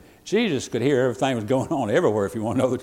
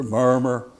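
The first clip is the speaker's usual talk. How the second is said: ordinary talk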